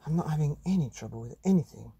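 rushed fast answer; quick child reply. Acted. Boy brushing off parents, fast reply. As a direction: hurry it out. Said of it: "said John".

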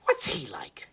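gossipy whisper; whispered aside. Acted. Gossiping about Nicholson.

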